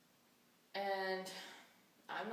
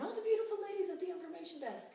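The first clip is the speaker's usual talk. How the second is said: high, loud customer greeting; imitated customer; 11 semitones higher, 5 dB louder, faster than usual. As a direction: put on a gushing customer, pitched well up. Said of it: "they refer to me as Miss and Ma'am".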